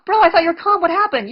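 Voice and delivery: bro, you were calm, high mocking jeer; mocking taunt. Real, not acted. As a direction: needle him with a high jeering voice. Taunting angry opponent.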